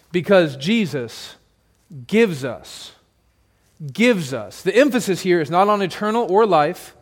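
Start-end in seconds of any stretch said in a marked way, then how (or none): 2.1-4.6 "gives" weighted and falling, said twice; stress on gives. Shown as a demonstration. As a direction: lean on the word "gives". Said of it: "It's on gives".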